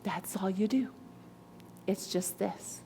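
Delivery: lightly voiced husband, hushed and low-key; husband's reply. Retelling her husband's reaction to the audience, softly voiced. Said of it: "And my husband's like".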